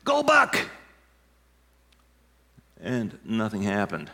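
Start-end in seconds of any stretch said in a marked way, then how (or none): 0.0-0.6 high, loud call to a mule; yelled command. Retold or imitated. Farmer yelling to his blind mule, high and loud. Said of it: "the man yelled out".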